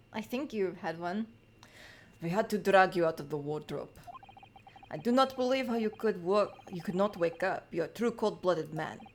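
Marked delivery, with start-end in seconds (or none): 2.2-9.0 acted Russian-accented accuser. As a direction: read it in a firm Russian accent. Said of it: "are you meant to be Russian too?".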